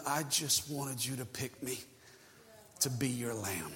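soft, low, heartbroken; sorrowful Jesus. Acted. Jesus grieving he was not chosen, soft sorrowful voice. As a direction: speak it as a quiet ache. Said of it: "And he knew".

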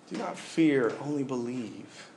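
soft, slow repeat. Shown as a demonstration. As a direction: let it sink in gently. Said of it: "repeats the verse softly".

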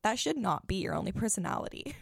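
ordinary delivery

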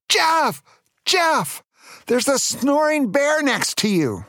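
high-pitched mock yell of alarm. A narrator's reading. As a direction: yell a warning in a squeaky high voice. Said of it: "he yelled".

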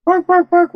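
high yappy barking; dog barks. Pretend. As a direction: bark like a little dog. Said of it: "what if the dog was saying".

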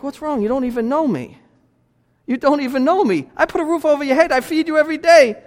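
high-pitched acted parental protest; exasperated parent. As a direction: play a parent incredulous at their worried kid. Voicing an exasperated parent to anxious child.

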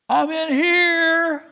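put-on high, loud, drawn-out child's call; hiding toddler. Small child calls to the seeker from hiding, high and eager to be found. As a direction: call out slowly in a high little-kid voice.

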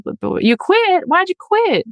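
high, pushy disbelief; a mock dismayed adult who asks why; shocked prying reaction. Shown as a demonstration. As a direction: sound dismayed at the quitting. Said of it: "it's like this".

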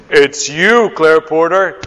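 a touch of a game show reveal; lottery ad announcer. Retold or imitated. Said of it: "lottery ad when he goes".

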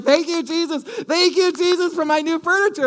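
high, loud praise of joy; wife overjoyed, thanking Jesus; excited thanks. Retold or imitated. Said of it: "She got all excited".

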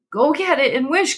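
high, eager urgent cry; trembling with eagerness; quivering excitement. A narrator's reading. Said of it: "cried his wife, quivering with excitement".

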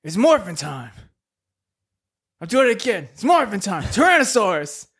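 half-hearted superhero call; mock morphin yell. [name] yelling the morph call at his belt, hammed up. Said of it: "I keep yelling".

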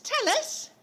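excited plea, voice jumping up; pleading excitedly. Real, not acted. Eager to hear the secret.

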